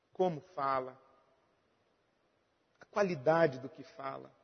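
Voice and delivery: low, very soft sermon voice; hushed contemplative preaching. Real, not acted. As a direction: preach it intimately, dropping low with long pauses. Pregador em tom baixo, contemplativo. (pregação contemplativa, grave e bem baixinha)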